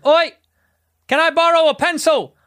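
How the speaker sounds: loud, high shout across the cabin; shouted question to the whole plane; loud call. Acted. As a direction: call out to test if everyone sleeps.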